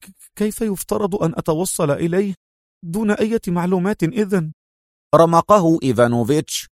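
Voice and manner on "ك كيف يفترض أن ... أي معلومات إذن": higher, stammering disbelief; stunned mumble. Acted. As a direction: caught off guard, stutter the question. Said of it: "في دهشة مغمغما". (دهشة متلعثمة)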